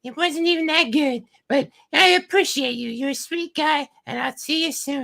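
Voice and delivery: very high falsetto brush-off; mock girl voice. Date saying goodbye after the big bill, mock girl voice. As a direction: let him down in a put-on girly falsetto. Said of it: "then she's like".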